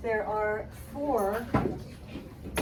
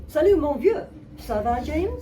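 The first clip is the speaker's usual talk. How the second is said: louder, livelier doorman; teacher rerunning the doorman's lines, louder; faster doorman greeting; 5 semitones higher, 8 dB louder than usual. Acted. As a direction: toss the greeting off with energy. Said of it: "I'm gonna go through this faster".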